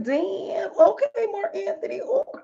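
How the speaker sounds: high, swooning "damn, okay"; impressed swooning. Retold or imitated. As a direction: gush like a smitten fan.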